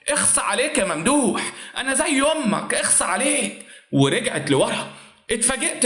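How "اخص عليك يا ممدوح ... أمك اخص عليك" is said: a staged indignant outburst; mock scream. A narrator's reading. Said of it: "بتصرخ بصوت عالي". (صرخة تمثيلية مستنكرة)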